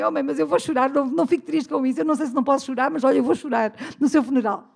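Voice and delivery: playful teasing. Retold or imitated. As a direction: loudly act out teasing your mother. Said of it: "disse aquilo na brincadeira com a mãe".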